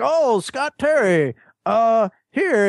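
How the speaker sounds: lightly voiced friend offering a flag; imitating Heimbach. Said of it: "he's like".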